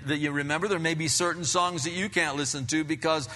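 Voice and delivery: ordinary talk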